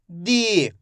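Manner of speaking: दी with a long drawn-out ee; stretched pronunciation. Shown as a demonstration. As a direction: hold the vowel of दी, then let it fall. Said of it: "मैं इसका खींच के उच्चारण कर रहा हूँ".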